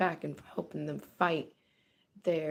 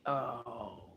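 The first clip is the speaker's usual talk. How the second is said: dismayed oh no; 10 semitones lower, 6 dB quieter than usual. Retold or imitated.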